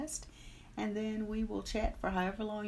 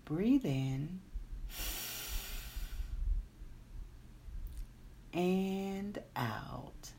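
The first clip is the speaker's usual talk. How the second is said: guided meditation; 4 dB quieter than usual. Acted. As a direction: guide them calmly into the day. Leads a meditation.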